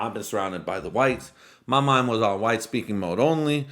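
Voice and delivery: usual speaking voice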